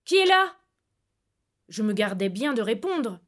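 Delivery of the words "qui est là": loud, higher, alarmed challenge; shouted, higher demand of who's there; forceful demand. Acted. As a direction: call out hard into the dark. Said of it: "La voix demanda avec force".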